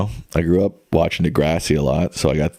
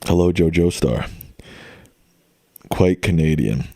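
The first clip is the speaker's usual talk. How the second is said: usual speaking voice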